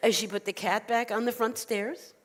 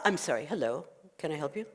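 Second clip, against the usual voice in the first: low, soft, collected voice; composed rabbi; 4 semitones lower, 5 dB quieter than usual. Acted. Rabbi recovers after shouting at visitor, composed. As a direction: drop down into polite calm.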